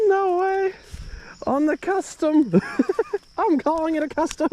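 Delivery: high, giggly, laughing through words; laughing disbelief. Real, not acted. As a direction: squeal it out in delighted disbelief.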